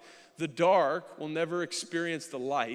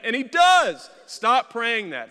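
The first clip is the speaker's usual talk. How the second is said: comic outburst; 7 semitones higher, 15 dB louder, slower than usual. Real, not acted. Comic outburst after the wife's prayer.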